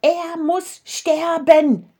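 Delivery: high, loud, slow shout of the crowd; crowd screaming. A narrator's reading. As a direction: scream out the death demand, drawn out. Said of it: "schrien alle".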